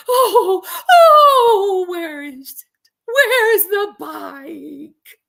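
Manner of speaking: high, loud, drawn-out howl; hammed-up wailing cry, words stretched out; mock wail. Storyteller mimicking the man hunting for the bike, mock wailing. Reenacting the man's plaintive wail.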